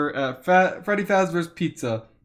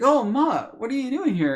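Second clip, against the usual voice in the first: higher, surprised nurse voice; 11 semitones higher, 4 dB louder than usual. Retold or imitated. Nurse surprised to find the boy at the door. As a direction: sound startled at the door.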